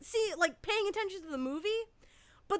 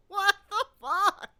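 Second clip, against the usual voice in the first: louder, slowed, drawn-out disbelief; stunned disbelief; 5 semitones higher, 6 dB louder, slower than usual. Retold or imitated. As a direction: let the disbelief stretch out. Disbelief at strangers' fight.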